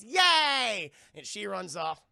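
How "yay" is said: high, loud drawn-out "yay"; mock cheer. Lois cheering before she runs off, mock glee. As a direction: squeal a silly celebration. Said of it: "She goes".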